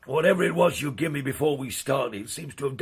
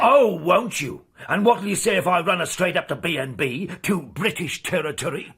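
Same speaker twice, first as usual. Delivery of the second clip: angry challenge; 3 semitones higher, 4 dB louder than usual. Acted. Skipper bullying crewmate in quarrel.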